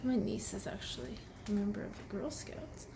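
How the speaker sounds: whispered aside. Real, not acted.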